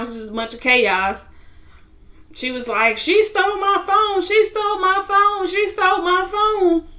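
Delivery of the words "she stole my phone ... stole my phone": much higher put-on voice, insistent; imitating a high-pitched, aggrieved tattler; shrill accusation. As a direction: squeal the accusation over and over in a high mock voice. Retold accusation amid fight chaos.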